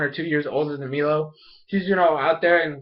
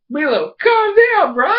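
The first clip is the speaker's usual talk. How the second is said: high, loud, slowed plea; mock exasperated yell; 13 semitones higher, 6 dB louder, slower than usual. Viewer telling Milo to calm down, mock exasperated yell. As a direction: yell it at him in hammed-up disbelief. Reacts to Milo's outburst.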